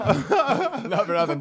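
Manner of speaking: laughing. Real, not acted. Laughing at the cheek joke.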